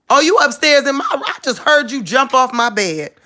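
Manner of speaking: high-pitched scolding of a pet; calling upstairs. Real, not acted. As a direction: holler a playful telling-off upstairs. Scolding dog from downstairs.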